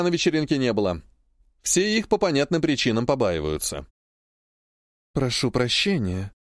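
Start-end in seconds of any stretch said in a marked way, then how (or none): 5.1-6.3 whistling voice. Acted. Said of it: "свистящий голос, судя по тембру женский".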